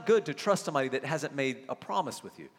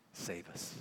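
whispered; 8 semitones lower, 14 dB quieter than usual. Real, not acted. Sermon climax dropping to whisper.

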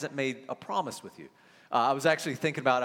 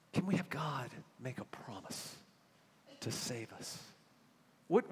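ordinary talk

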